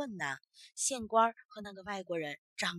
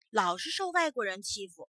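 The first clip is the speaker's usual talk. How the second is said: ordinary delivery, nothing marked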